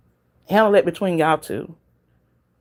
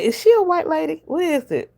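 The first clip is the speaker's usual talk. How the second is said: surprised question; 12 semitones higher than usual. Retold or imitated. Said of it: "I said".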